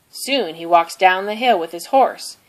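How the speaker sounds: plain reading voice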